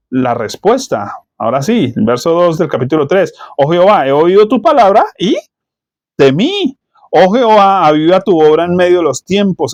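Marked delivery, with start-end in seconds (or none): 3.6-6.8 loud rising reading of the prayer, peaking high on temí; dramatic scripture reading. Acted. Pastor reads Habakkuk's prayer to the church, loud and awed. 7.1-9.7 dramatic scripture reading. Acted. Reads Habakkuk aloud with force.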